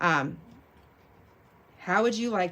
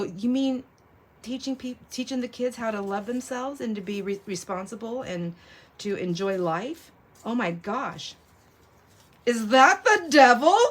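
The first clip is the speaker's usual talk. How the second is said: mock horror; 4 semitones higher, 4 dB louder than usual. Mock outraged retold reply.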